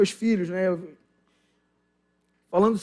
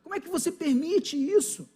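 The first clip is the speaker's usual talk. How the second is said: incredulous rebuke; 6 semitones higher than usual. Retold or imitated. Pastor scolds imagined lax parent.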